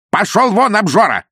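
high, loud, snapping retort; annoyed wife. Acted. Wife telling her greedy husband to go away. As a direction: shoo him off in annoyance. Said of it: "ответили из комнаты".

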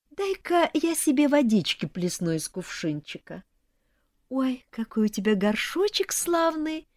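high-pitched, syrupy fake concern; mock-innocent cooing. A narrator's reading. In-law breaking the bride's teapot, falsely apologetic. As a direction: coo sweetly over the damage you meant to do.

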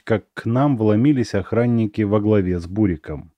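ordinary delivery

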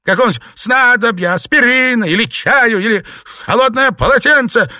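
loud, high furious scolding; scolding rant. A narrator's reading. Mistress berating her maids, angry rant. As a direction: tear into the useless servants.

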